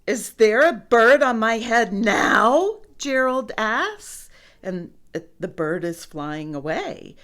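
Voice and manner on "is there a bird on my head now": loud, raised, rising question; worried elephant calling out; anxious question. A narrator's reading. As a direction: ask it urgently, ending high. Elephant anxiously asks again.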